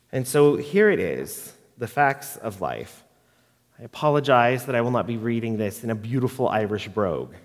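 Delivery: normal talking voice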